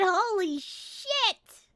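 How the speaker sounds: high and loud, laughing through the words; cackling with delight at the news; squealing with glee; gleeful laughing. Real, not acted.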